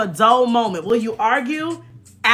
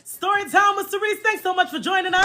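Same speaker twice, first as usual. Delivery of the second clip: high playful outro; 8 semitones higher than usual. Real, not acted. Closing bit, high.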